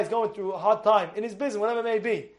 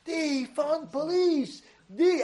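high, slow megaphone chant; imitated megaphone; 6 semitones higher, 3 dB quieter, much slower than usual. Megaphone at the rally demanding defunding, slow high imitation. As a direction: drone it out like a protest megaphone. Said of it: "You know the megaphone?".

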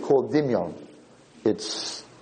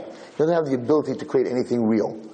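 normal talking voice

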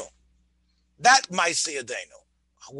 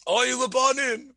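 lightly acted moan, higher; moaning heavenly voice; 5 semitones higher than usual. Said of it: "the bas kol that was moaning".

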